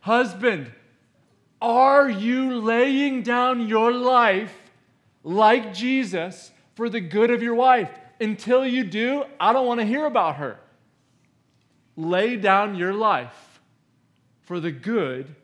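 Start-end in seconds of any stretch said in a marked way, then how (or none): 0.0-10.6 raised, loud, high-pitched challenge; stern demand, voice lifted; forceful confrontation. Acted. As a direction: confront him at full pulpit volume. Pastor acts confronting a husband.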